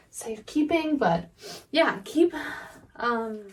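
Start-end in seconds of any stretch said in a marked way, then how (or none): none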